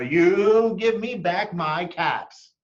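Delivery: slow, high scolding demand. A narrator's reading. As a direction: wag a finger at the monkeys. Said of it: "he said, shaking a finger".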